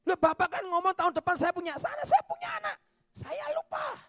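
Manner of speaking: squeaky comic dialogue; comic high squeak. Retold or imitated. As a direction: act a flustered reminder. Congregant reminds forgetful prophet.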